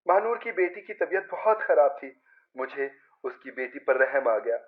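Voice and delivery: ordinary delivery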